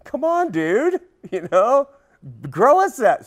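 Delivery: a casual, amused God nudging his friend; mock God voice. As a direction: play God as a buddy ribbing Job with a laugh. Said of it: "God was like".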